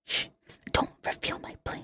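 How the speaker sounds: very soft, playful hushed whisper; conspiratorial whisper. Pretend. Hushing a friend who exposed her plan, mock-secret whisper. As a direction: shush them and whisper it.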